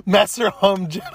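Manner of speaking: laughing and crying with laughter. Real, not acted. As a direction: crack up on the words. Laughing at the joke.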